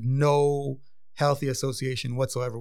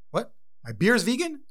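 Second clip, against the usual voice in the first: the stunned Russian guest who just heard his beer is vegan; shocked customer; 9 semitones higher than usual. Retold or imitated. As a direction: pitch jumps way up in disbelief.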